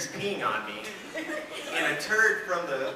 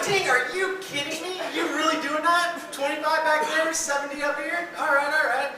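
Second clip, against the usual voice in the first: much higher, incredulous griping at the driver; mock outrage at being cut off; exasperated; 10 semitones higher than usual. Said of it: "I'm like".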